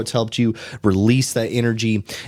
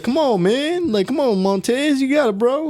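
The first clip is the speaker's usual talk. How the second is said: high-pitched hyped-up encouragement; mimicking gym buddy; 13 semitones higher than usual. Gym buddy urging the speaker through dips, high hyped encouragement. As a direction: cheer him on like a gym partner, way up high. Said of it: "he's like".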